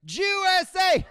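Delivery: high, loud stadium chant; mock sports-fan shout, slow on the chant; imitating dad yelling. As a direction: bellow it at the TV like a fan. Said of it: "He's yelling at the screen".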